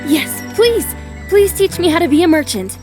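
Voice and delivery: high, excited pleading; eager plea. Acted. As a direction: beg eagerly to be taken on. Heroine begs to join merchants.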